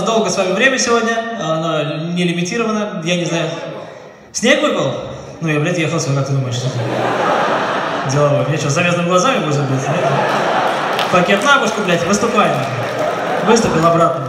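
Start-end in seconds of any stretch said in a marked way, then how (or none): none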